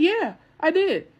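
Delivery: high, slow, careless yeah; callously casual; careless admission. Retold or imitated. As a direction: shrug it off. Dad casually admits.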